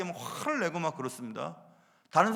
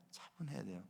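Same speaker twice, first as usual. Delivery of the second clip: calm; 7 semitones lower, 16 dB quieter than usual. Shown as a demonstration. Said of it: "차분해야 됩니다".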